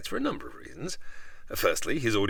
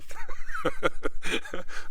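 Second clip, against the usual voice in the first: a sudden high burst of laughter; flattered laugh; 4 dB louder than usual. Real, not acted. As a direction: laugh it off, pleased and a little embarrassed. Flattered by the compliment.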